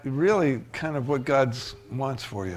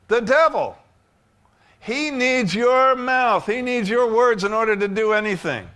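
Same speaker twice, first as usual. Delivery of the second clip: preaching at full force; 9 semitones higher, 6 dB louder than usual. Acted. Preacher warning the congregation about the devil, booming. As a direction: thunder it out from the pulpit.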